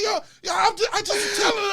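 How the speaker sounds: high, hurried backpedalling, half laughing; high-pitched defensive stammer; mock flustered excuse. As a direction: imitate someone protesting in panic. Flustered excuse voiced in quarrel.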